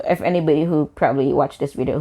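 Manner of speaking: ordinary talk, nothing marked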